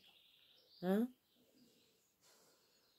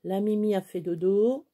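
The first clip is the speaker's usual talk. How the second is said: ordinary delivery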